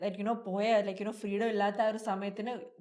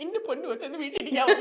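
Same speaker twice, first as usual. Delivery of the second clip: worried parent; 3 semitones higher, 4 dB louder, much faster than usual. Pretend. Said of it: "you know how parents get worried".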